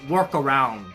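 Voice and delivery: ordinary talk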